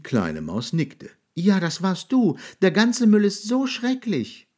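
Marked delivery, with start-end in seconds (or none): 1.3-4.5 high squeaky mouse. A narrator's reading. Mouse tells the monster the mess is his, high. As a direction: answer in a high little mouse voice.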